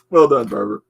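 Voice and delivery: ordinary delivery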